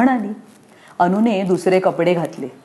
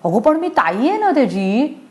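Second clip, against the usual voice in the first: lightly voiced whiny child, higher; whining little girl; 6 semitones higher than usual. Anu whines to her mother, lightly higher. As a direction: whine it like a sulky big sister. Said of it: "Anu कुरकुरली".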